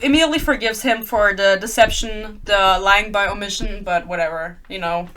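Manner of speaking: ordinary delivery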